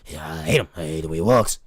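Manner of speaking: lightly done [name] imitation, low and heavy. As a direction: drop way down and channel [name].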